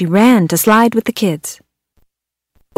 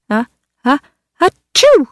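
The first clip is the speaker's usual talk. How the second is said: a big high puppy sneeze; uh, uh, achoo with a high sneeze; 6 semitones higher than usual. Acted. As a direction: build up and sneeze. Dog sneezes in snow.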